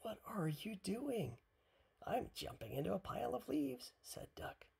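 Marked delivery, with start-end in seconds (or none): 0.0-1.4 high, slow exclaimed question; high-pitched, incredulous-style question; crying out. A narrator's reading. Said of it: "cried Hippo". 2.1-3.9 high, lively character voice; high storybook duck voice; playful Duck. A narrator's reading. Duck's playful reply in a story.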